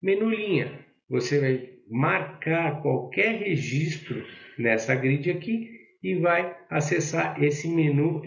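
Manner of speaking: ordinary delivery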